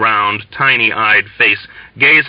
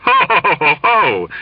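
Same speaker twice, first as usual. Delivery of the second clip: much higher hearty ho ho ho; swelling jolly laughter; booming ho ho laugh; 8 semitones higher than usual. A narrator's reading. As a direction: indulgent merry laugh. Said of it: "indulgent merriment swelled tremendously".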